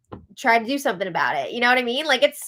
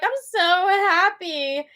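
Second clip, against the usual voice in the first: mock teary; 7 semitones higher, slower than usual. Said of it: "Mackenzie starts crying".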